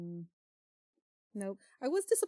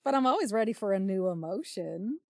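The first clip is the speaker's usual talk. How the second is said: a quick, higher, louder aristocratic tone; quick Henry line; 3 semitones higher, 7 dB louder than usual. Acted. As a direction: toss the line off fast like a jaded lord. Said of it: "Henry so quickly says".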